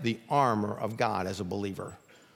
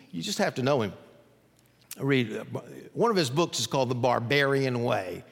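usual speaking voice

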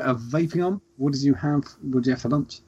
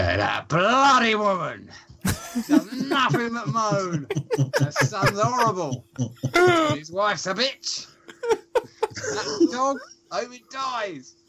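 put-on much higher, slow, nasty old lady gossip through a laugh; imitating Nan Peggy's bitchy aside; 12 semitones higher, slower than usual. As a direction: turn from sweet to spiteful behind her back. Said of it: "she go".

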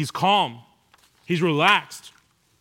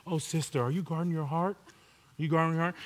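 mock churchy sister; 2 semitones lower, 8 dB quieter than usual. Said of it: "be like".

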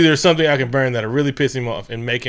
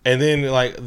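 ordinary talk

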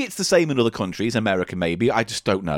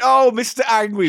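an amused DJ voice, higher and louder; Steve Wright DJ accent; 7 semitones higher, 4 dB louder than usual. Pretend. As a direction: DJ indulging his angry caller. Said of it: "and I'll be Steve Wright".

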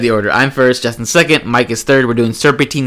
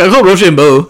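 high, laughing put-on voice; mock friend; 6 semitones higher, 7 dB louder than usual. Teasing a friend about Rochambeau, mocking him. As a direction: mimic him with a laughing sneer. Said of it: "Mike's like".

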